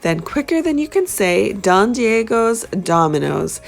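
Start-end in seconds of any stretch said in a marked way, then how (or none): none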